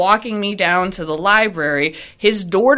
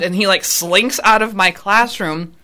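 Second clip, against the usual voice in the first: ordinary talk, nothing marked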